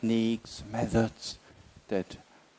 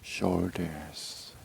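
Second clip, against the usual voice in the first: slow, measured meditation guidance with long silences; unhurried body-scan voice, words drawn out; guided meditation. Real, not acted. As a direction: ease them slowly into relaxation, phrase by phrase. Leads a body relaxation meditation.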